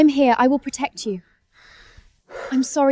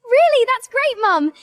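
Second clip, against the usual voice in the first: overjoyed; 10 semitones higher than usual. Acted. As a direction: burst with joy at the news. Overjoyed at tuition news.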